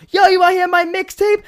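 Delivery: loud, high hustler voice; street hustler pitch. Retold or imitated. Street hustler pitching his mixtape to sister, loud call. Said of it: "He goes".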